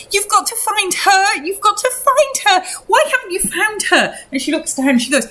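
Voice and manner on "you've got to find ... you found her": high-pitched, desperate pleading; distraught mother. Acted. Acting mother begging for missing daughter.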